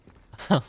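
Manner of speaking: short loud laugh; loud burst of laughter. Real, not acted. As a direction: laugh out at the misreading. Laughs after misreading.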